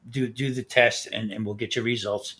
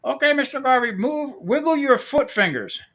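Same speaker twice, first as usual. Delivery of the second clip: imitating the Indian technician; 11 semitones higher, 4 dB louder than usual. Telling the teller to wiggle his toes, mock Indian accent. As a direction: lightly mimic the technician's broken English. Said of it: "and he says".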